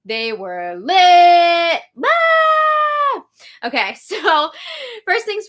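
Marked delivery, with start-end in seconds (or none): 0.0-1.8 drawn-out, higher hype on lit; hyped-up. Pretend. Hyped recap of the feeds. 2.0-3.2 long, high-pitched mock scream; playful squeal. Mock scream after they were lit.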